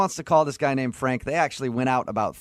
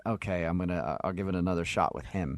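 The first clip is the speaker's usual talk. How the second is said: resigned low mumble; 8 semitones lower, 7 dB quieter than usual. Retold or imitated. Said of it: "now you're like".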